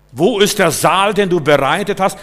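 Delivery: booming preacher question; calling out. Real, not acted. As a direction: preach it out to the hall.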